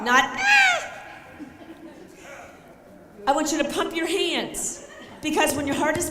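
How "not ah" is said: very high, thin, loud squeal; a weak, high yelp to avoid; wrong squeak. Shown as a demonstration. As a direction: coach showing the wrong sound, high squeal. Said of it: "make the sound of Serena Williams".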